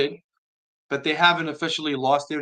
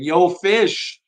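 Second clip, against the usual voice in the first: hammed-up Long Island "Yo, Fish", louder and higher; Long Island accent; 5 semitones higher, 6 dB louder than usual. Host imagining a viewer greeting him in a Long Island accent. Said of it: "I just imagine a Long Island accent saying".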